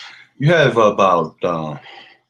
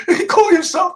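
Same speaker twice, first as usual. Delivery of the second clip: words breaking into laughter, higher and louder; laughing in disbelief; 6 dB louder, faster than usual. Real, not acted. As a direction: laugh through it, can't believe it. Laughing at the lawyer's claim.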